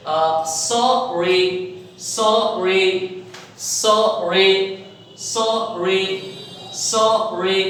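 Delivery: सॉरी slow, one r; सॉरी said slowly with one r; single r in sorry. Shown as a demonstration. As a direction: drawl सॉरी with a single r tap. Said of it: "ये single आर consider किया जाएगा".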